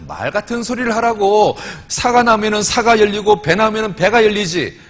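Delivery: imitated indignant retort; exasperated scolding. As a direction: scold as if it were obvious. Deaconess scolds the pastor again.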